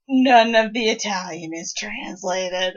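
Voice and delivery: usual speaking voice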